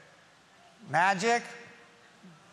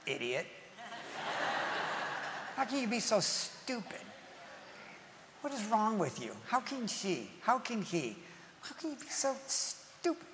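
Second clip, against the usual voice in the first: softer, under-the-breath contempt; muttered insults; 3 semitones higher, 8 dB quieter than usual. Retold or imitated. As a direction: grumble it to yourself in the car. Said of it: "You say".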